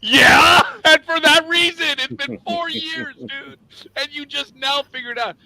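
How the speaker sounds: high, loud, cracking with laughter; loud mock-crying laugh; laughing shout. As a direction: shout it through helpless laughter. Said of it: "And I'm like".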